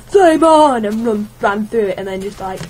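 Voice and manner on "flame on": loud, high battle cry; heroic shout. Retold or imitated. Gamer retells running through fire, loud heroic shout. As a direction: shout it like a superhero. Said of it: "I went".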